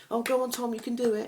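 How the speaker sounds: loud, high-pitched imitation of parents; parents egging on. Parents urging Tom into the water, imitated encouragement. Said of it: "your parents are being".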